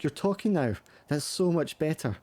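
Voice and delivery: put-on high, relieved reassurance to a child; soothing mother. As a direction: coo encouragement to a little one. Mother soothing daughter who finally talks.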